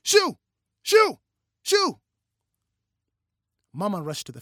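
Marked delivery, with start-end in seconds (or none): 0.0-2.0 short, high, very loud shouts; sharp high yells, each on its own; shouting. A narrator's reading. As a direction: shout to drive a dog away.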